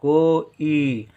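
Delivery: कोई stretched out very slowly, each sound held; slow teaching pronunciation of कोई; drawn-out demo. Shown as a demonstration. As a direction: draw out कोई so every vowel is heard. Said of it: "तो का में ओ की मात्रा".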